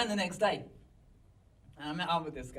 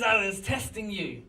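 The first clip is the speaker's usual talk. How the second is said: higher, louder incredulous re-quote; amused mock reveal; 4 semitones higher, 6 dB louder than usual. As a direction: repeat his reveal in amused disbelief. Storyteller re-quotes the supposed lunatic, amused.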